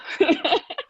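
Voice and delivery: high tearful laugh; real laugh after holding back tears; emotional laugh. Real, not acted. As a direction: release the tension with a laugh. Finishing emotional tribute to friend.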